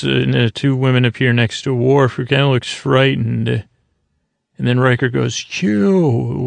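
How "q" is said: a mock yell of a single name, higher; capital loud. Riker calling out Q, mock yell. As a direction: call the name out sharply, retold. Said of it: "with a capital loud".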